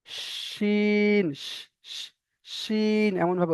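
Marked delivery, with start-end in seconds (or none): 0.1-1.2 শিন with a pressed sh and a long drawn vowel; pressed sh shin. Shown as a demonstration. Teacher contrasting letters, pressed drawn শিন. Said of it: "চেপে উচ্চারণ হবে". 1.4-2.1 breathy pressed sh, no voice; whispered sh sound. Shown as a demonstration. Teacher isolating the sound, whispered sh. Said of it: "চেপে উচ্চারণ হবে".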